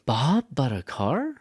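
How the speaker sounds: "Bob" loud and high, dip, then rise; exaggerated disbelief that it was "Bob"; surprised stress on BOB. Shown as a demonstration. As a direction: punch "Bob", drop, question upward. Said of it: "I go down from those things".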